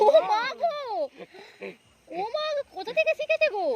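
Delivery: high amazed exclamation. Real, not acted. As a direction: gasp in delighted disbelief. Amazed at child's words.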